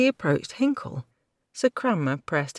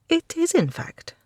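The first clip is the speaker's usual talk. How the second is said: higher, mocking grudging apology; a lightly voiced cheeky girl sneering through it; sarcastic young woman; 13 semitones higher than usual. Kirsten voice.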